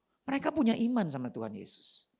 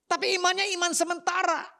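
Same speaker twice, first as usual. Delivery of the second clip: ordinary talk, nothing marked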